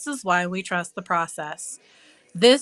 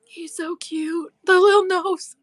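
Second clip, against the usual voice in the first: high gushing; 11 semitones higher, 5 dB louder than usual. Real, not acted. Gushing over gnome.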